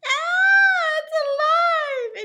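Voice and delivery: very high, loud shriek; frightened scream. A narrator's reading. Lady shrieking when the doll comes alive. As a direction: shriek in terror, drawn out. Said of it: "The lady screamed".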